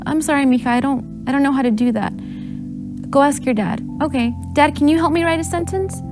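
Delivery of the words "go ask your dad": ordinary delivery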